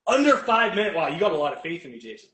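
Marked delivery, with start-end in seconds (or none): 0.1-1.0 higher and louder, a disbelieving echo; astonished, throwing the question back; surprised. Real, not acted. Echoing an outrageous challenge.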